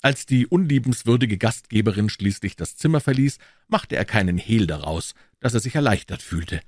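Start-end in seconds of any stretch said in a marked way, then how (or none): none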